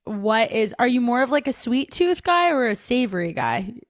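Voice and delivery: ordinary talk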